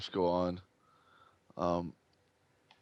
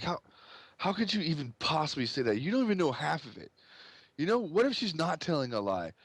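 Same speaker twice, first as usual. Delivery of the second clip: higher-pitched outraged dad; upset, indignant father; 6 semitones higher than usual. Acted. Father answering an accusation against his daughter, indignant. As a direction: argue back as an offended, protective father.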